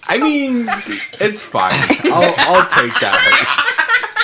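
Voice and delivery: high laughing voice. Real, not acted. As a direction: laugh through the words.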